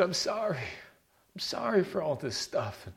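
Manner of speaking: sarcastic whimpering sorry; mock a sappy dream-vision Jesus saying sorry; whiny apologizing Jesus. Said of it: "crying and whining and apologizing to you".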